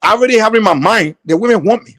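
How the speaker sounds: normal talking voice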